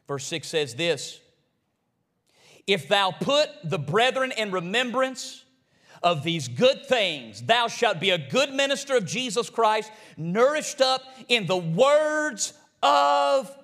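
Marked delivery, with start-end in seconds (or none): 2.7-13.6 slow, loud, drawn-out Bible reading; slow scripture reading. Acted. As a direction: lay each word of the verse down slowly and loudly. Reads scripture from the pulpit.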